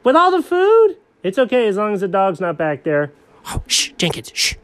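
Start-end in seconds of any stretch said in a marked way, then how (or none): 3.5-4.5 whisper shushing. Acted. Shushing while hiding.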